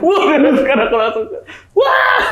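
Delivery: high, loud startled wail; imitated group scream. Everyone screaming at the possessed woman, mock shriek. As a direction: cry out like a shocked crowd, half laughing. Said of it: "pada teriak semua".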